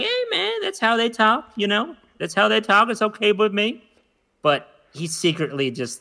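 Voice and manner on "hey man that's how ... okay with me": easygoing Southern dude, pitched well up; high, laid-back Southern impression; cool Southern guy. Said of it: "he's cool about it".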